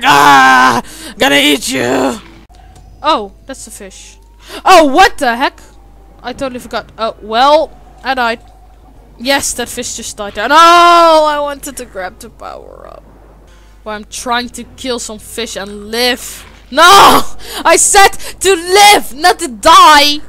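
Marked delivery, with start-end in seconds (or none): 0.0-2.2 loud hammed-up monster roar; mock angry fish. Streamer voicing his angry fish chasing prey, mock roar. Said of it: "Look at my expression, it's like". 16.7-20.2 frustrated yell. Real, not acted. Yelling at game.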